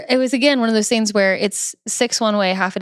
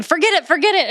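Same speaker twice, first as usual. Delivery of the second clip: mock yell; 8 semitones higher, faster than usual. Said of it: "we're gonna be yelling".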